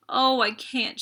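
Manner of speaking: high, loud anguished cry; a wild sob of despair. A narrator's reading. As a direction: break down sobbing on the words. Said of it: "she cried".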